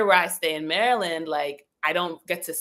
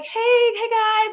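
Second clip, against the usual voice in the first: bright greeting; 5 dB louder, much slower than usual. Retold or imitated. Greeting angels on waking.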